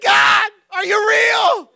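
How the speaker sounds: slow, high, loud mock outcry; despairing cry to God. Struggling believer shouting at God, mock desperate cry. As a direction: wail it at heaven, hammed up.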